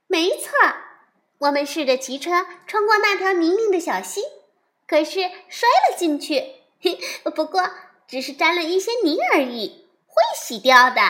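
bright, high and quick with a grin; grinning little bear talking fast with a laugh; smiling voice. Acted. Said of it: "小熊妹妹咧嘴笑着说". (咧嘴笑着，语速很快)

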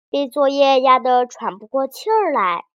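ordinary delivery, nothing marked

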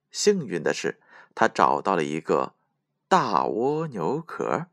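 ordinary delivery, nothing marked

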